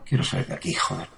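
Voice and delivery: hushed, panicked whisper; frightened whisper. Real, not acted. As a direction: whisper it, desperate to get out. Frightened patient begging to leave. (susurro asustado y desesperado)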